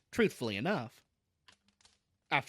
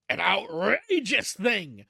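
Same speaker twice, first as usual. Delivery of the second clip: slow, emphatic, higher and louder; an outraged uncle booming it out slowly; violent outrage; 4 semitones higher, 5 dB louder, slower than usual. A narrator's reading. Uncle reacting to family scandal, violent outrage. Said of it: "said Uncle Benjamin violently".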